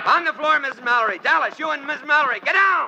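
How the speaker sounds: high, urgent warning shout. Acted. Ringo warning Dallas and Miss Mallory to get down, urgent shout. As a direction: get them down now, shout it. Warning women under attack.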